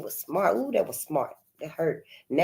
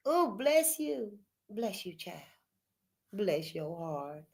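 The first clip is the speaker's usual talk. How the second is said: soft, slow, sugary "bless you"; mock a gentle church blessing, soft and slow; sweet church lady; 12 dB quieter, slower than usual. Imitating a church lady blessing someone, soft and sweet. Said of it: "say it for what it is".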